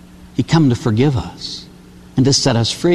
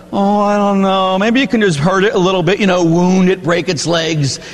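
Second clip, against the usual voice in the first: reluctant bargaining; 4 semitones higher than usual. Retold or imitated. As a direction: stall and haggle in a raised voice. Acts man bargaining to avoid killing.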